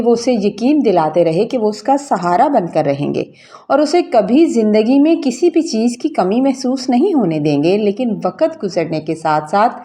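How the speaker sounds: ordinary delivery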